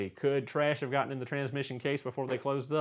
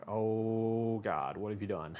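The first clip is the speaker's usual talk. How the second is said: retold groan of dismay, a bit lower; mock dismay; 3 semitones lower, 5 dB quieter, slower than usual. Mechanic retelling his reaction to buddy's mistake, mock dismay. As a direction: sigh it out like you just saw a disaster. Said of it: "And I was like".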